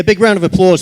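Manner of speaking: host rousing the audience to applaud, loud; calling out. Real, not acted. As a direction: call the hall to clap.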